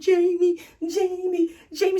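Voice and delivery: high, mock dreamy sleep-talk; Brienne. Captor mimicking the name she kept saying asleep, mock dreamy calls. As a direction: imitate someone calling a name in their sleep, high-pitched.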